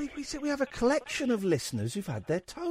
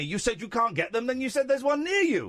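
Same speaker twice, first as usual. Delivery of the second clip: raised, incredulous protest; indignant challenge, pitch swinging up; exasperated accusation; 5 semitones higher, 3 dB louder than usual. Real, not acted. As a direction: catch them out with mounting exasperation. Host catches caller contradicting himself.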